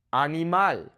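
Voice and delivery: Animal said the Spanish way, ah-nee-MAHL; Spanish "Animal" with clear open a vowels; Spanish pronunciation. Shown as a demonstration. Teacher models a Spanish word, Spanish Animal with open vowels. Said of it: "focusing on the vowel sounds".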